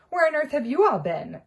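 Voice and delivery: a touch of a stern portrait; scolding portrait. Acted. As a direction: demand an answer, affronted. Fat Lady scolds the students.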